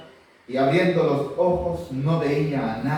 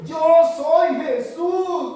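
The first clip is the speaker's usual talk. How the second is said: voice of Jesus; 11 semitones higher, 5 dB louder, much slower than usual. Acted. Voice of Jesus answers Paul.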